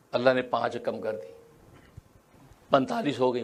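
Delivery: normal talking voice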